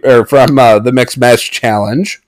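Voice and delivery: usual speaking voice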